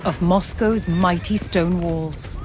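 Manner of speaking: ordinary talk, nothing marked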